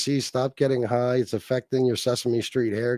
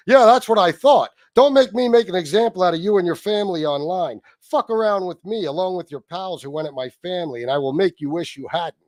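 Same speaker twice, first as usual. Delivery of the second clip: higher, mocking read of a threat; 4 semitones higher than usual. Streamer rereading a rival's threat to viewers, mocking impression. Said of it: "try to read it in J C's voice".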